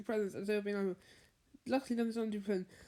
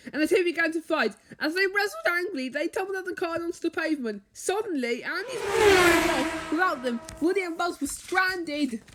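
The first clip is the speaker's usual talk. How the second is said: higher and louder, building tension; dramatic action narration; 6 semitones higher, 7 dB louder than usual. A narrator's reading. Narrator telling the toys' fall from the car, urgent. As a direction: tell the fight with rising alarm. Fight and stranding scene.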